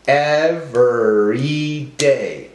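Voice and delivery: slow, drawn-out ev-er-y-day; broken into syllables. Shown as a demonstration. Teacher helping class count syllables, slowly syllable by syllable. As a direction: sound out each syllable slowly. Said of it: "break up our words into syllables".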